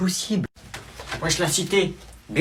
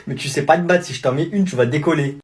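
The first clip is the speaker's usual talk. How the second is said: ordinary talk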